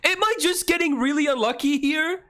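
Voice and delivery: very high-pitched, outraged rant; frantic exasperation. Real, not acted. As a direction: cry out in disbelief at your rotten luck. Ranting at repeated bad luck.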